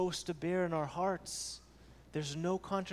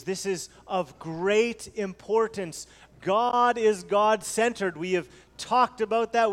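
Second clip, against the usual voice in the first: louder fervent pulpit delivery; preaching at full force; 4 semitones higher, 7 dB louder than usual. Acted. Pastor urging the church that God is supreme, fervent preaching. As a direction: drive the point home to the congregation.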